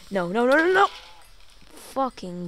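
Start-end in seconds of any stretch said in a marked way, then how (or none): 0.1-0.9 loud desperate no; panicked shout. Real, not acted. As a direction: yell in panic. Creeper about to explode.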